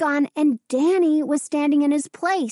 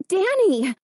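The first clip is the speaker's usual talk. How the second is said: high, sudden startled cry of a name; startled gasp; 8 semitones higher than usual. A narrator's reading. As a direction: blurt the name out in shock. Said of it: "and gasped, startled".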